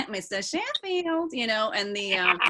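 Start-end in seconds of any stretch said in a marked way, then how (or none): 0.1-1.3 put-on slow, posh-leaning Queen's English accent through a laugh, pitched up. Explaining accents to the panel, a laughing Queen's accent. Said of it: "if you think about the Queen's accent".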